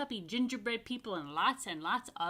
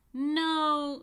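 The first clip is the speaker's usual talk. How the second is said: firm no; 7 semitones higher, 9 dB louder than usual. A narrator's reading. Child refusing friend.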